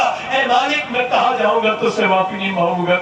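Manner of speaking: normal talking voice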